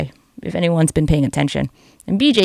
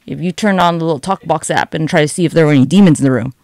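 ordinary delivery

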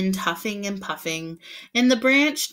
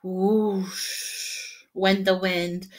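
slow, drawn-out whoosh; whooshing wind; much slower than usual. A narrator's reading. Narrator imitating the wind, slow whoosh. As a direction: blow the word like wind.

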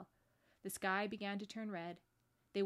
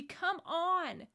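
high, loud urging; rally a scared sister to move; insistent child's call; urgent; 9 semitones higher, 7 dB louder than usual. A narrator's reading. Lucy urging Susan to turn.

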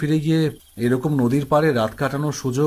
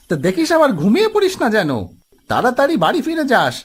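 high-pitched grandpa giving a warning; cautioning grandpa; 8 semitones higher than usual. Acted. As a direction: admonish them in a high grandpa voice. Grandpa warns the young men. (দাদুর চড়া গলায় সাবধান করা)

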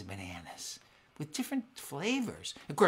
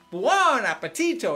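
Italian exclamation; 8 semitones higher, 9 dB louder than usual. Shown as a demonstration. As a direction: announce the meal like an Italian host. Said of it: "so let's try that".

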